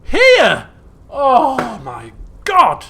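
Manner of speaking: loud slow wail; loud drawn-out despair; real dismay. Real, not acted. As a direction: wail in disbelief. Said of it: "player dismayed at autosave".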